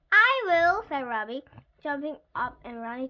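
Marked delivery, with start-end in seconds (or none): 0.1-0.8 loud, high eager shout; eager volunteering. A narrator's reading. Eager pupil volunteers at once.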